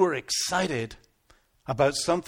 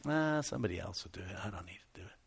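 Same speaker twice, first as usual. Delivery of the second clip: low, very soft, offhand shrug; apathetic; 9 semitones lower, 12 dB quieter, much faster than usual. Acted. Churchgoer leaving the work to others, low and apathetic. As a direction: toss it away quietly, fading lower.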